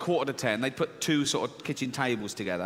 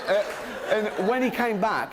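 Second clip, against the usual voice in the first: ordinary talk, nothing marked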